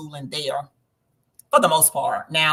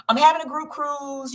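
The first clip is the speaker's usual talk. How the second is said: higher, brighter put-on friend voice; imitating her girlfriend; 10 semitones higher, 4 dB louder than usual. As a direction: mimic the friend excitedly announcing. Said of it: "She was like".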